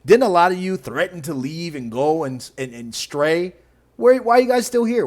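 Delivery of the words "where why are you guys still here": higher and louder, jabbing the question; taunting shout. Real, not acted. Taunting the lefties who threatened to leave, loud jeering question. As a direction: yell it at them like a taunt. Taunting losing voters, raised voice.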